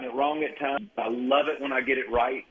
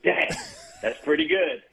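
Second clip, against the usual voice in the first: retold "Dang, that's pretty good" jumping much higher on "good"; imitating his own amazed reaction, pitch leaping on "good"; impressed surprise. Retelling his reaction to a hit song, amazed and higher. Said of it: "And I went".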